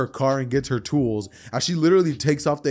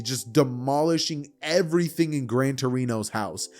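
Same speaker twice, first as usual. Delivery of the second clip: ordinary delivery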